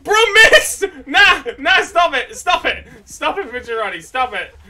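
loud, exasperated yelling; angry crying. Real, not acted. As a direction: yell at him to stop, loud. Teammate attacking him.

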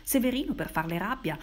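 plain reading voice

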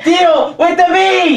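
high, slow, loud shouted order; mock yell. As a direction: bellow it like a frantic command. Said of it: "You just said".

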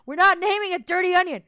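shouted refusal, high and loud; loud protest. Real, not acted. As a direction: shout down the chat's idea. Refusing chat's name suggestion.

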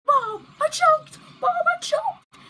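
very high, loud mock wail to mom; hammed-up shrieking child; mock wailing kid. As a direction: imitate a kid bawling for mom, drawn out. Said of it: "He's like".

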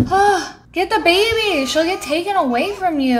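gasp, then high, loud urgent cry; alarmed outburst. Real, not acted. Player warns the mother her baby will be taken, alarmed.

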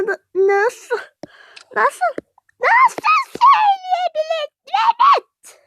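high, drawn-out cry of disbelief; outraged yelling. Acted. Wife to husband over what his mother said, outraged yelling. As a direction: rise into a slow, high, indignant yell. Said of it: "Sen hala bağırıyorsun".

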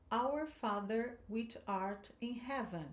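slow, clear reading to repeat after; teacher giving a line to repeat; model line for repetition. Shown as a demonstration. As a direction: say it slowly for the class to echo. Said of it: "Então vamos lá".

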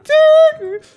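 Xi as a loud, very high squeal; squeaky high. Shown as a demonstration. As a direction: stretch "Xi" way up top. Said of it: "ça part dans l'aigu".

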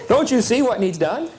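usual speaking voice

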